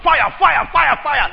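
high, loud shouted cry of fire; calling out fire. Retold or imitated. Woman in church crying out in tongues, high loud shout of fire. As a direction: belt out fire like a woman swept up in worship. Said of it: "I call out".

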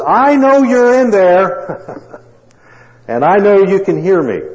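high, loud and slow call; calling loudly through a door. A narrator's reading. Doctor calling through the door to the hidden husband, loud. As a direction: call out to a man shut behind a door. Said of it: "He called loudly through the door".

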